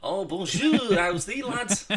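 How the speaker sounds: loud, high mix of French and Yorkshire; mock French-Yorkshire. Co-host joins the joke with a loud French-Yorkshire greeting. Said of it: "from French to Yorkshire".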